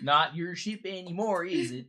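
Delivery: teasing Swedish-Canadian character voice; Credence. Acted. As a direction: needle them in a lilting Swedish-Canadian voice. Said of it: "I'm good old Swedish Canadian".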